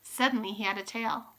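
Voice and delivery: usual speaking voice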